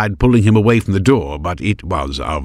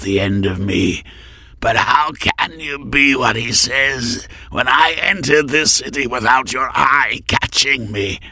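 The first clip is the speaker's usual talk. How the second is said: raised, sneering villain voice; menacing assassin; 5 semitones higher than usual. A narrator's reading. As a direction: taunt him coldly. Assassin taunts Arcos.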